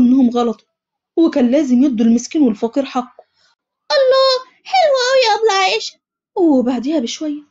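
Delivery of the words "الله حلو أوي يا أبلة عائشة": put-on high, quick child's voice, delighted; a young pupil gushing over her teacher; little girl. A narrator's reading. Little pupil thanks teacher Aisha, high delighted child voice.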